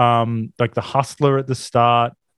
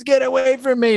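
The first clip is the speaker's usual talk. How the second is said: imitating Naomi's outburst; 11 semitones higher than usual. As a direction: push her off with a shrill cry. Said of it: "And she goes".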